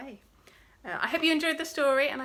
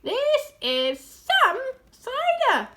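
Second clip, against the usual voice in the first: high, loud, word by word; gasped amazement; 12 semitones higher, 8 dB louder, much slower than usual. A narrator's reading. Smallest fox marvelling at cider, slow amazed cry. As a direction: spell it out slowly, loud and high. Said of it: "gasped the smallest fox".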